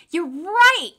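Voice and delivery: high, loud eager cry; excited exclaim. A narrator's reading. Boy tells the doctor he guessed right, excited. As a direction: blurt it out, thrilled. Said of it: "I exclaimed".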